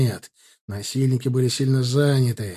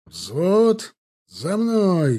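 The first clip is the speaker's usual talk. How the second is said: barked command; 5 semitones higher, much slower than usual. A narrator's reading. Officer barking to platoon to follow. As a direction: bark it out to lead them. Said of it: "гаркнул Гончаров".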